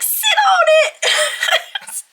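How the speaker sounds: high, loud, stretched-out shouted catchphrase; Family Feud quote. Retold or imitated. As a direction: belt it out slowly with a laugh. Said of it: "she just quotes that one where they're like".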